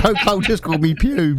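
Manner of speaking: buzzing with excitement. Retold or imitated. Fan retelling a footballer using a nickname, giddy and buzzing. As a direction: replay being starstruck, voice lifted through the laughter. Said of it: "I was absolutely buzzing".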